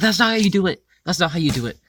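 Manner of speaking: high, loud retold objection, easing on the repeat; exaggerated outcry, pitched well up; mock alarmed protest. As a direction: act out a panicked correction.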